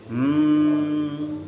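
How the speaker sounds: long, steady closed-mouth hum; bhramari Gunjan. Shown as a demonstration. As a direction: hum on one held note, as in Bhramari breathing. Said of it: "थोड़ा सा भ्रामरी करना है". (भ्रामरी की लंबी गुनगुनाहट)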